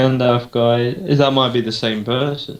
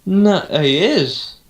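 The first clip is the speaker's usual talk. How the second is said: slow stunned disbelief; 7 semitones higher, much slower than usual. Real, not acted.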